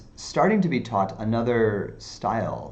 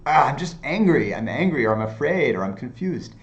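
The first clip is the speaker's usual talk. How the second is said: mock angry outburst; 5 semitones higher, 5 dB louder than usual. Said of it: "And I'm like".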